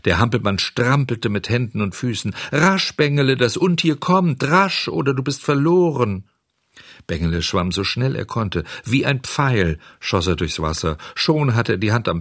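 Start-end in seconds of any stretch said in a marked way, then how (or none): 2.5-6.2 high, urgent goat warning; a hint of a bleating goat; urgent goat cry. A narrator's reading. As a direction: goat urges the puppet to hurry before the shark. Goat urges Bengele before shark.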